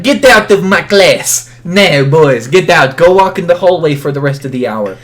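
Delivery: loud mock French teacher ordering out; poor French accent. Student imitating teacher banishing him from class, poor French accent. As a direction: scold loudly in a badly faked French accent. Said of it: "trying to do very poor French accent".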